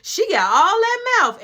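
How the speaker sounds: high, loud sass; imitated indignant comeback. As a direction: act out a woman firing back. Rival snaps back in quarrel.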